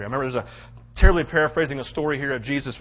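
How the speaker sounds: ordinary talk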